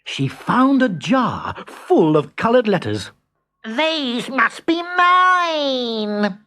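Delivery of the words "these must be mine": high, very slow fairy voice; Belinda's delight. Acted. Belinda finds the jar of letters, slow high wonder. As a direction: draw it out slowly, high and wondering.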